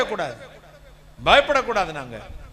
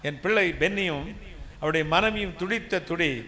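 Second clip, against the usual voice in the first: ordinary delivery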